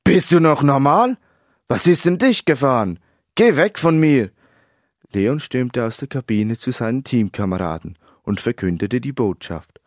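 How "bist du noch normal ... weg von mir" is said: loud, high, appalled yell; indignant, explosive rejection; outraged shouting. A narrator's reading. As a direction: shout him away in outrage. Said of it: "Empört schrie Leon".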